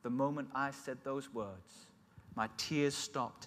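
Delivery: ordinary talk, nothing marked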